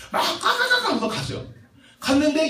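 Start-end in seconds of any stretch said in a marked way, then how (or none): 0.2-0.9 very high "kka-kka-kka"; comic high monkey travel noise; imitated monkey chatter. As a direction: squeal out rapid monkey noises.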